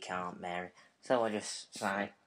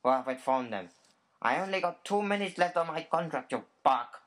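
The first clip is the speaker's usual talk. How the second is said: irritated mock outburst; 7 semitones higher, 5 dB louder than usual. Pretend caller frustrated shout.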